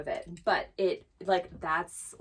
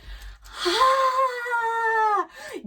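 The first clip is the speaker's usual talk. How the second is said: sudden high frightened yelp; a real shriek of fright; startled cry; 8 dB louder than usual. Real, not acted. Startled by a noise.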